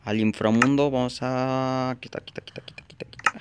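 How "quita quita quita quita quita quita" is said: whispered, hurried repeats; urgent whisper. Real, not acted. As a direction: hiss it out quickly under your breath. Urgently clearing something in game.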